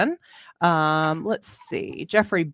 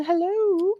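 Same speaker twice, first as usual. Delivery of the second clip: sing-song hello; 11 semitones higher, 4 dB quieter than usual. Real, not acted. High greeting to viewer.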